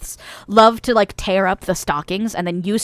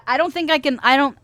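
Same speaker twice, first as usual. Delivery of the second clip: normal talking voice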